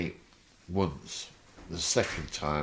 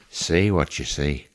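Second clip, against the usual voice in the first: imitating Roy Walker; 3 semitones lower, 7 dB louder than usual. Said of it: "put his face in mine and says".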